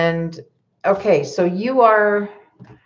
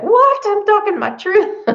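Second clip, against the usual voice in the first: much higher, louder what, half-playful; mock indignant surprise; 3 dB louder than usual. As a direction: protest your innocence in a high mock squeal. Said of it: "And you'll be like".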